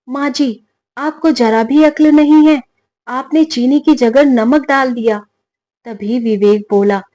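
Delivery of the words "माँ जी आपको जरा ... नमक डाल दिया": angry shout. Acted. Daughter-in-law yelling at her mother-in-law over salty kheer, angry shout. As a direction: scold her sharply, shouting. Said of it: "गुस्से में चिल्लाकर बोली".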